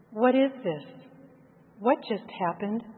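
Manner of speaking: ordinary delivery, nothing marked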